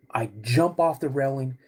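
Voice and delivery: normal talking voice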